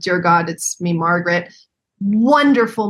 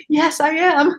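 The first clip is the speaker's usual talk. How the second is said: put-on Irish accent; 7 semitones higher, slower than usual. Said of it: "in his Irish accent".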